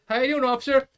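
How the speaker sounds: high, loud, sugary-sweet greeting to the cop; overly polite greeting. Retold or imitated. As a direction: play innocent and chipper for the officer. Greeting cop while girlfriend swears.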